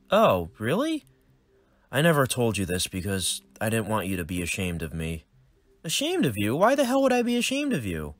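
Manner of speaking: put-on a nerdy boy greeting his dad; cheerful young son. Son Wade reacts.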